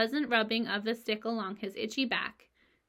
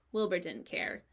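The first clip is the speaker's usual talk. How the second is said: plain reading voice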